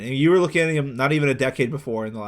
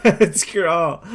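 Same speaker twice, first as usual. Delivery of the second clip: loud, high, slowed by laughter; real laughing fit; 6 semitones higher, 7 dB louder, much slower than usual. Real, not acted. Host teasing the year-by-year photo search, laughing hard. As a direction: crack up while getting the words out.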